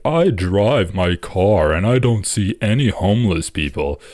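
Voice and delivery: slow, mocking imitation of an out-of-touch host; Bill Maher. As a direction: draw it out, imitating someone oblivious. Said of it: "when Bill Maher was like".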